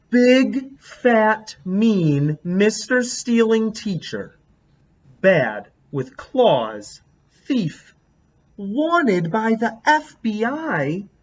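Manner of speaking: big loud start, then slow and drawn out; angry kid's drawing caption. Acted. Reading out her angry drawing of the teacher, slow and spiteful. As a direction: read the mean picture's labels with relish.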